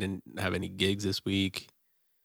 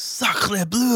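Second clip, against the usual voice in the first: high, loud French exclamation; French accent; 12 semitones higher, 5 dB louder than usual. Retold or imitated. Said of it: "He says".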